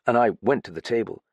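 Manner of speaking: ordinary delivery, nothing marked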